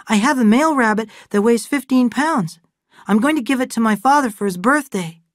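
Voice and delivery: put-on high, eager child's voice; a shy boy proudly showing his rabbits; eager young boy. Aaron boasts about rabbit.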